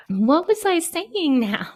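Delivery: high, slow, giggly question; amused and lost, drawn out high; laughing confusion. Real, not acted. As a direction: ask it through laughter, pitch way up. Lost her thread, laughing.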